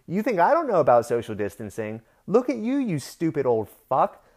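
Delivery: heated retort, short of a full shout; retold angry yell. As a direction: replay yelling back, exasperated and indignant. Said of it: "I yelled back at him".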